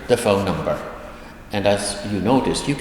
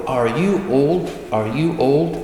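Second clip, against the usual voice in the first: lightly voiced grandchild, higher pitched; curious grandchild; 5 semitones higher than usual. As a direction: lift the question like a child asking grandfather. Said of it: "This is a grandchild talking to her grandfather".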